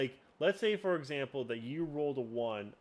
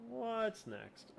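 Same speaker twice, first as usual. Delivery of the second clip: much higher and softer, a light put-on voice; squeaky high repeat; 11 semitones higher, 5 dB quieter than usual. Host echoing his own question in a tiny high voice. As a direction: pitch it way up and pull it back in volume.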